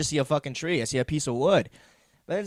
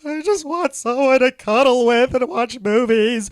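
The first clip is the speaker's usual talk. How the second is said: slow, high, louder pitiful whine; mock whimper; 8 semitones higher, 7 dB louder, slower than usual. Telling why he bought the TV, pitiful mock whine. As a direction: whine it like a lonely sad sack. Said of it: "It was more like".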